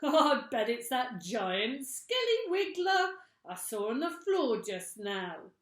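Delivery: high, loud mocking old man voice; gruff old man. Mister Twit taunting his frightened wife. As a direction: tease her with glee.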